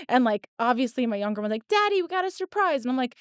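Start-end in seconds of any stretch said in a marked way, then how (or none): 1.7-2.8 put-on high singsong kid voice spilling a secret; mock little kid telling Daddy; imitated young child. Younger child blurting to dad, retold.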